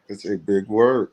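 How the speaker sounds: "It's a big word." dragged out slow and low, each word weighed; slow low drawl. Pretend. Asked to say it again, he gives a slow low mock-serious read. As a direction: lean back and drawl it out heavy. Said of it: "Say it one more time".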